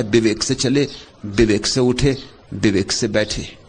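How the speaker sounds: low, soft quoted recitation; quoting Mahavira. Retold or imitated. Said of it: "Mahavir ने कहा है". (धीमे, नीचे स्वर में शास्त्र-वचन)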